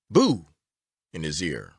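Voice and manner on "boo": a lightly voiced, louder "Boo!"; mild mock shriek. A narrator's reading. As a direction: jump out and shout "Boo!" half-heartedly. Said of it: "waved their arms wildly above their heads and shrieked out".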